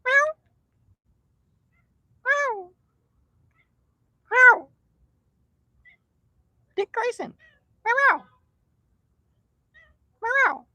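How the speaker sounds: high, repeated meows calling the cats; sing-song high meows to the strays. Acted. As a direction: meow like a cat to draw them near. Meowing to call cats.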